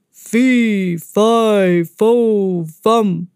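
booming loud giant chant; thundering giant. Acted. Giant approaching the room, a loud thundering chant. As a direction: boom each word like a giant. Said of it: "thundered a voice".

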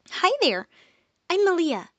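overly cheerful hello; way too happy. Acted. Malia greeting the rescued pixie, way too happy. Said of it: "She was way too happy".

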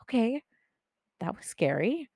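tentative self-talk after a scare, retold; uneasy okay. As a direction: say "Okay" high and questioning. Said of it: "this is what I said".

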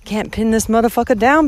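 normal talking voice

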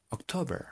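October very softly, posh English-style; posh "gomelo" pronunciation. Shown as a demonstration. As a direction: say a hushed preppy October. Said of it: "solo que más gomelo". (October gomelo, muy suave)

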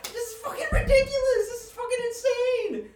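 high-pitched, loud freak-out; spazzing-out yell. Retold or imitated. Retelling a coworker's meltdown in a packed store, shrill spazzing yell. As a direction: shriek it like someone losing it in front of everyone. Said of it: "she just starts spazzing out".

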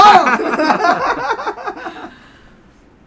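high, loud burst of laughing; long helpless laugh; loud laughter. Real, not acted. Comedian cracking up at the mix-up, loud laughter.

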